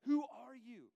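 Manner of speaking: high, very loud frantic demand; going nuts. Retold or imitated. Impure spirit confronting Jesus, going nuts. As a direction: yell it like you are losing it. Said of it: "you know, going nuts".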